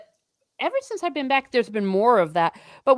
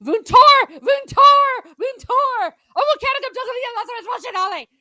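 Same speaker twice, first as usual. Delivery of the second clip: loud, much higher begging call to the teacher; a desperate kid who needs the toilet; pleading schoolchild; 8 dB louder than usual. Shown as a demonstration. Showing how pupils begged to go to the toilet, loud high pleading. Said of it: "you have to say it like this".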